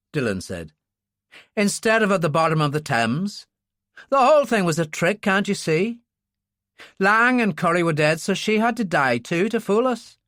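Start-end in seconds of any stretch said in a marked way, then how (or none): 1.6-10.2 higher, insistent character voice; Dylan's agitated realisation. Acted. Dylan explains the trick to Ferguson, agitated. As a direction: press the point as the truth dawns.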